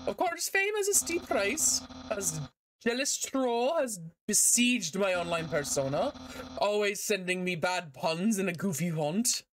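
lofty, theatrical complaint; put-upon celebrity in a cartoon voice; self-important skeleton character. Voices game character's dialogue.

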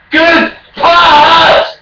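loud mocking praise; raging gamer. Real, not acted. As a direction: shout it up high and loud.

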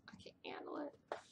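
breathy whisper, half to herself; whispered under her breath; whispered aside. Real, not acted. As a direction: drop to a whisper. Aside about her conscience.

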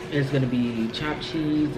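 ordinary talk

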